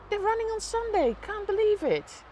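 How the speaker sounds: high, loud disbelief; excited. Real, not acted. Watching firemen run on Sunday, loudly thrilled. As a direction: gush about it, thrilled.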